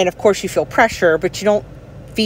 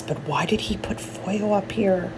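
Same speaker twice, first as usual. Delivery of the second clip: puzzled whisper through a numb mouth; 8 dB quieter than usual. Real, not acted. Puzzled about foil in mouth.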